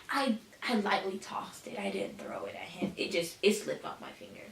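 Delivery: normal talking voice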